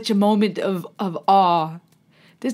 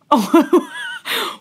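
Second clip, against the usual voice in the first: a high, breathy laugh of disbelief; high disbelieving laugh; 7 semitones higher than usual. Real, not acted. Watching the singer's impossible range, a high laugh of disbelief. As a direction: laugh out in shock.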